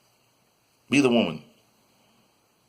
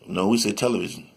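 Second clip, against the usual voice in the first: ordinary talk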